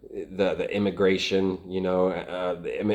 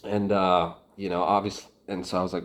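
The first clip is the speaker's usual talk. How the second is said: usual speaking voice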